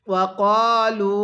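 drawn-out chanted recitation; slow melodic Quranic chant. Shown as a demonstration. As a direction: recite it like a tajweed teacher. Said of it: "পড়ুন".

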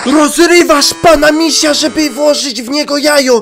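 high, loud disbelieving cry; outraged high squeal; shocked outcry. Real, not acted. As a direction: yelp it out in shock. Shocked outburst at game choice.

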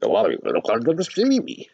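high, slowed, odd little fish voice, comforting; talking fish. Acted. Fish answers.

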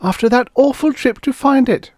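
high, loud wailing complaint. A narrator's reading. Karen laments the lost rocket to Guthrie, wailing. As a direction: keep the voice up high in dismay. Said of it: "wailed Karen".

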